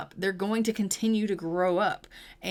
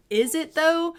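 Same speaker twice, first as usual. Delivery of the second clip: higher, louder doubtful question; skeptical challenge; 8 semitones higher, 5 dB louder than usual. Acted. Wife answers husband's delight at babies aging up, openly skeptical. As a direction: push back hard on the cheerful remark.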